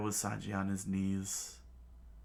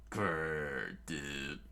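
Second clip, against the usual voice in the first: mild growl; 6 semitones lower, 4 dB quieter than usual. Acted. Luffy growling angrily.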